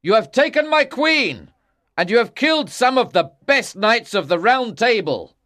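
loud, high-pitched accusations; angry shout. A narrator's reading. King challenging Lancelot at his castle, angry shouting. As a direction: hurl each charge at full voice. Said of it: "shouted the king".

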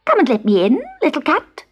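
high, wheedling goblin voice; coaxing hobgoblin. A narrator's reading. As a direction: lure the kitten sweetly. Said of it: "said the hobgoblin".

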